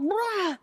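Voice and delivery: playful imitation of angry roar; mock roar. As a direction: roar like you're about to pounce. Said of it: "I would be all".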